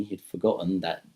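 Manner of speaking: usual speaking voice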